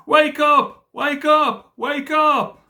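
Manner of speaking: high, loud panicked call to wake; high-pitched, loud repeated calls; urgent cry. A narrator's reading. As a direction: cry out shrilly to rouse a sleeper. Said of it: "and they cried".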